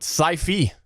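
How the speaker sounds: Sci-Fi said as "sigh-fee"; long i first, "fee" ending on Sci-Fi; blended pronunciation. Shown as a demonstration. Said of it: "Combine the two".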